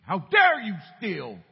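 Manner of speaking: slow, high, booming rebuke; mock berating. God as he was not, berating the boy, loud mock fury. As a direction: thunder it out as an imitated scolding. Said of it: "There wasn't a berating".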